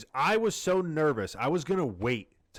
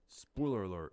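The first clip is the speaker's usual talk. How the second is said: lower, softer mock spoiler warning; 7 semitones lower, 7 dB quieter than usual. Shown as a demonstration. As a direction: mutter the warning like a mock disclaimer. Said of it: "You gotta say that".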